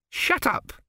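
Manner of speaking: high, curt order; curt queen. A narrator's reading. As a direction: slap the words down curtly. Queen cuts him off.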